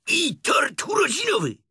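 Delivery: high, loud tiger roar. A narrator's reading. Tigger demanding birthday cake, high loud roar. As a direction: bellow it out at a high pitch. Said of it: "ryknął tygrys".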